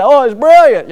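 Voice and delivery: mock-proud parent, bursting with joy; proud parent delight. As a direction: gush like a dad hearing his child's first word. Proud parent hearing first word.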